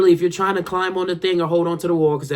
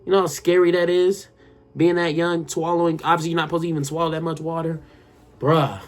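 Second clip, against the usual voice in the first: ordinary talk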